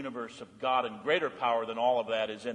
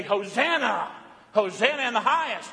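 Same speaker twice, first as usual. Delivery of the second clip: joyful acclaim; 8 semitones higher, 4 dB louder than usual. Shown as a demonstration. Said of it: "we say".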